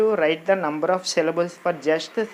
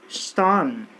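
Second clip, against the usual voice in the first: ordinary talk, nothing marked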